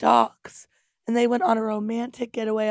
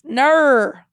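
put-on Australian accent; 4 semitones higher, 7 dB louder than usual. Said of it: "her favorite word that she hears is".

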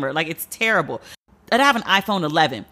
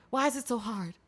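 near-whispered weary despair; exasperated whisper; 13 dB quieter than usual. Real, not acted. Ranting about health advice online, fading to an exasperated whisper. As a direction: let the rant collapse into a hushed plea.

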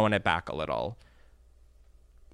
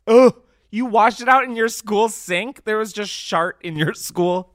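shocked disbelief; 8 semitones higher, 4 dB louder than usual. Real, not acted. Disbelief at washing shart underwear.

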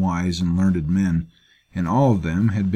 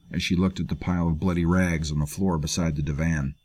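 plain reading voice

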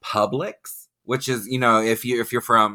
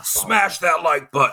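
higher, punchy mock influencer call; hammed-up plug; 7 semitones higher than usual. Host urging listeners to like the video, hammed-up plug. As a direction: push the slogan like a hype host.

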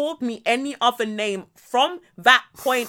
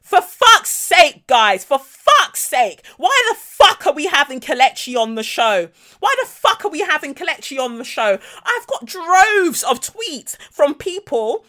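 hammed-up shouty exasperation; imitated angry rant; 5 semitones higher, 6 dB louder than usual. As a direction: rant like an exasperated boss caught off guard. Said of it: "shouting up and down".

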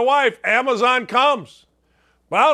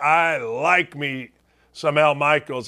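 ordinary talk, nothing marked

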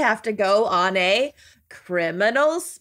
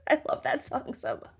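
whispering laugh; 2 semitones higher, 9 dB quieter, faster than usual. Real, not acted.

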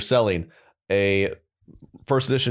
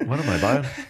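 ordinary delivery

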